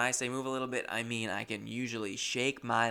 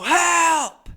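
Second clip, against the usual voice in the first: very high, very loud drawn-out scream; desperate full-force cry for help; yelling for help; 15 dB louder than usual. Acted. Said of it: "I usually yell as loud as I can".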